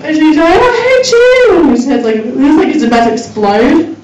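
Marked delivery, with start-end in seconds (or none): 0.6-1.6 put-on high, drawn-out kid wail; hammed-up high tantrum yell; mock child tantrum. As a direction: imitate a toddler's furious protest, slow and high. Toddler voiced, yelling at mum.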